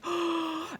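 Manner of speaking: quick excited gasp in; sharp gasp. Retold or imitated. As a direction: catch your breath at the sight. Spotting a colony of slugs.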